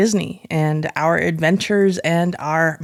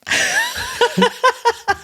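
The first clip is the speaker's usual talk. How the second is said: burst of laughter. Real, not acted. Forgot her own age.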